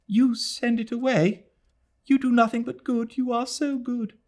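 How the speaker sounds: put-on high, quick, earnest girl's voice; fiancée comforting the mother; young woman consoling. A narrator's reading. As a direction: reassure her eagerly. Adela comforts Mrs Moore.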